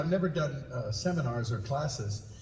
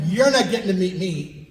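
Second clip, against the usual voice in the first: high, loud, laughing objection; mock protest; 6 semitones higher, 6 dB louder than usual. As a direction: protest in exaggerated fan disbelief. Mock protest to a famous admirer.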